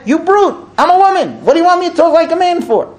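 high-pitched, offended wife's retort; indignant comeback. Acted. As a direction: throw it back at him, outraged.

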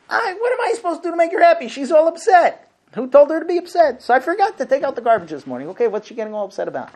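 high, fast, put-upon whine; mocking a husband griping that his wife is upset; whiny husband. Husband whining about upset wife.

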